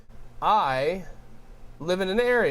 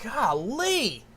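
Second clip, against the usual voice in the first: exasperated outburst; 10 semitones higher, slower than usual. Retold or imitated. Ranting at the annoying landlord.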